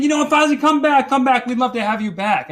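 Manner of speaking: high, eager pleading in a rush; high-pitched mock coaxing; townsfolk pleading. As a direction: mimic townsfolk begging a champion back. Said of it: "And they said".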